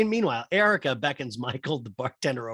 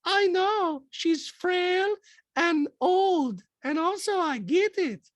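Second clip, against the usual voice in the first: very high mocking falsetto; Diana; much slower than usual. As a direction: imitate a haughty castmate in a squeaky voice. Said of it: "Diana goes".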